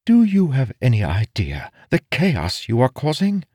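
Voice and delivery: high-pitched, incredulous scolding; indignant female voice; reproachful noblewoman. Acted. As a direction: take him to task.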